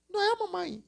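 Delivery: high, sing-song childish mockery; high whiny kid voice mocking an adult. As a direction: mimic a bratty kid answering back. Mocking kid's retort.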